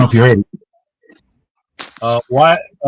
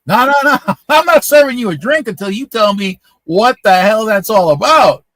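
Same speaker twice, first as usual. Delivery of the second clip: bartender insisting; 10 semitones higher, 7 dB louder than usual. Retold or imitated. Bartender demanding an explanation.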